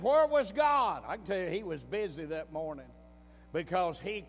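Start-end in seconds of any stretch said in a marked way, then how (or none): 0.0-1.0 louder, higher, slow and drawn out; preacher crying out the doubter's question; loud challenge. Acted. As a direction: throw the question out to the whole room. Preacher echoes the question loudly.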